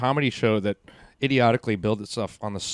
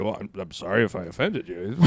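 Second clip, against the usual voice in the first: put-on lower, softer placid apology in an old man's voice; Larry King impression; 5 semitones lower, 6 dB quieter than usual. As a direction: mimic an unbothered old host. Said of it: "And Larry King's like".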